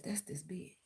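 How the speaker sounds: a very soft whisper; whispered aside. Real, not acted. Naming her rival in a secretive whisper. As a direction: drop to a bare whisper for the aside. Telling subscribers it's the rival.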